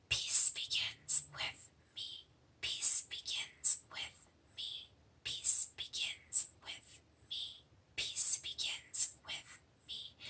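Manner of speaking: slow whispered mantra; soft slow whispered chant. Shown as a demonstration. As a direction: breathe the words out in a whisper. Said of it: "You'll whisper it".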